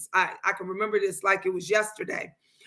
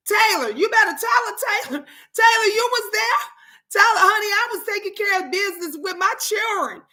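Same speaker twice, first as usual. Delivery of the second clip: animated protest; 8 semitones higher, faster than usual. Real, not acted. Playfully defending herself to Taylor.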